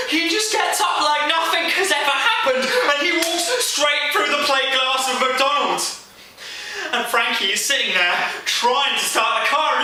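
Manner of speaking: loud, high-pitched action telling; frantic storytelling. Acted. Friend recounting the giant coming through glass at the car, frantic. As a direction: raise the voice as the danger closes in.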